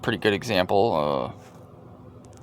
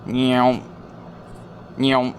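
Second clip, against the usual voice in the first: mock meow; 2 semitones higher than usual. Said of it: "Sound effects".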